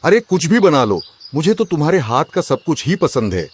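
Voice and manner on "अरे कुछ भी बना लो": normal talking voice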